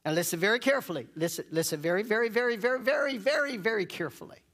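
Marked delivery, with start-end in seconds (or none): 2.0-4.4 "very" repeated higher and higher, each one punched; insistent repetition. Real, not acted. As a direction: pile up every "very" with rising urgency. Insistent build-up of repeated word.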